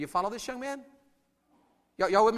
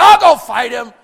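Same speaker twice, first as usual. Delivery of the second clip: loud, punchy volunteering; bold David shout; 3 semitones higher, 17 dB louder than usual. Acted. Preacher as David offering to fight, bold shout. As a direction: belt it out as a challenge. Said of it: "This is David right here".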